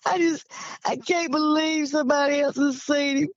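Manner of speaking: mock-tearful, overwhelmed disbelief; retold crying. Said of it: "he started crying. He said".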